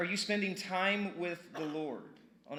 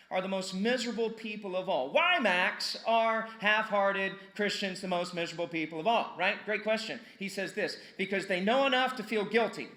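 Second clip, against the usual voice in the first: loud, higher, driving sermon voice; full-force preaching; 5 semitones higher, 5 dB louder than usual. Acted. Pastor pressing half-hearted Christians, loud and fervent. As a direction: hammer it home to the congregation.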